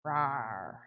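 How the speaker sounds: low, soft "rawr"; half-hearted little troll roar; playful monster growl. Pretend. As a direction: growl gently like a toy monster. Voicing the painted troll figure.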